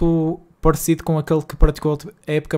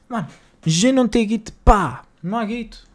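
higher, whining about being broke; whiny complaint; 6 semitones higher, 4 dB louder than usual. Real, not acted. Streamer to chat about his empty club budget, high whine.

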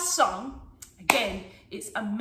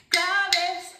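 higher call-out for copying; 5 semitones higher, 3 dB louder than usual. Shown as a demonstration. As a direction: call the name out in time. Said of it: "as you call the name claves".

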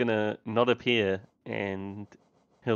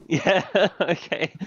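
words broken up by laughter; giggling through the words; laughing; 9 semitones higher, 5 dB louder than usual. Real, not acted. Laughing at teammate's blunder.

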